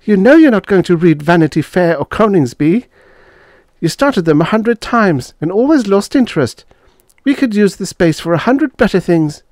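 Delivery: high-pitched wifely complaint; complaining. A narrator's reading. Wife wants the bookshelf space, complaining. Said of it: "complained Lucille".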